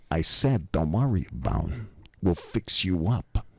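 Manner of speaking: low mobster insisting, slowing at the end; imitated East Coast tough guy; Pasquale. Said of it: "he says it just like this".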